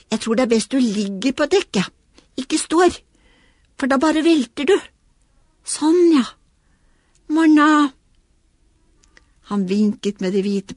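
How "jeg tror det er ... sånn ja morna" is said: put-on higher, eager little boy's voice; lightly voiced child, rising with excitement; small boy voice. Little boy launching his toy boat, eager. Lillebror sending Knerten off.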